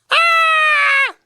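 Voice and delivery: put-on loud, long girl's shriek; high-pitched scream. A narrator's reading. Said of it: "she screamed".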